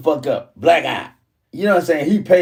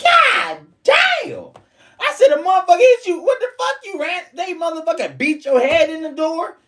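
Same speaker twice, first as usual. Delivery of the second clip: stunned disbelief. Retold or imitated. Hearing the door excuse, a very high incredulous cry. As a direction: squeal it out in disbelief.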